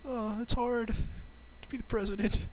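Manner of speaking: high, shy little voice; bashful Samuel L. Jackson. Pretend. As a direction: say it timidly, way up high. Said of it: "Sammy, you sound very bashful".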